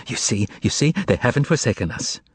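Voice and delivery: ordinary delivery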